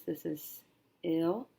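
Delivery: ordinary delivery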